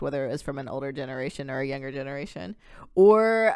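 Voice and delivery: ordinary talk, nothing marked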